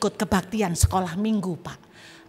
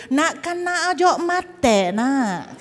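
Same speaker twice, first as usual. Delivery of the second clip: Madura dialect; 4 semitones higher, much slower than usual. Retold or imitated. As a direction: call it out like a Madurese neighbour. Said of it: "Kalau Madura kan gitu".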